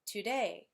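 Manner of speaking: today, weight landing on DAY; stress on second syllable. Shown as a demonstration. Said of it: "stress falls on the second syllable".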